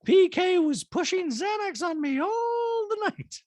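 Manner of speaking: very high, loud mocking impression; piercing, hammed-up high voice; Dorit. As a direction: squeal it out, stretching the last words. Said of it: "And Dorit's like".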